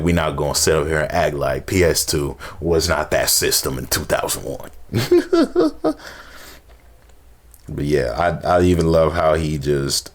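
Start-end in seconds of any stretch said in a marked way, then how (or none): 4.8-6.1 high run of short chuckles; amused chuckle. Real, not acted. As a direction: chuckle at your own aside. Laughs at own aside.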